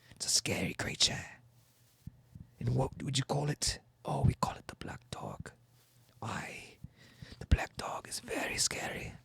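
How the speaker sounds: hushed whisper in a fake English accent; very soft, breath-only ghost-story voice; spooky whisper. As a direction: whisper it like an eerie legend.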